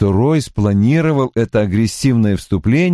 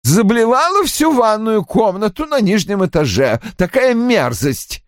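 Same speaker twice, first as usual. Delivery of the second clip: high-pitched, revolted complaint; querulous high voice full of distaste; disgusted; 12 semitones higher than usual. A narrator's reading. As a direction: complain in disgust, slowing on the last phrase. Roy recalls the mess in disgust.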